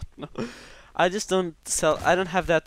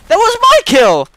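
high, loud, raging yell; furious shout; 12 dB louder than usual. Real, not acted. Gamer screams when another player steals his kill. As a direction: scream at whoever stole the kill.

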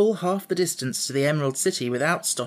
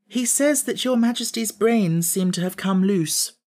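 tag demure; 6 semitones higher than usual. A narrator's reading. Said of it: "replied the girl demurely".

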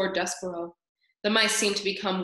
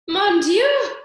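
much higher, much louder French cry; mother crying out at her son; shouted; 11 semitones higher, 10 dB louder than usual. A narrator's reading. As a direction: shriek it out in alarm. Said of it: "shouted his mother".

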